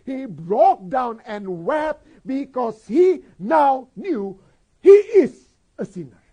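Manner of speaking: loud, high-pitched sermon delivery with drawn-out key words; impassioned preaching. Real, not acted. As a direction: preach it at full force, leaning on each phrase. Preaches at full force. (berkhotbah berapi-api dengan suara lantang)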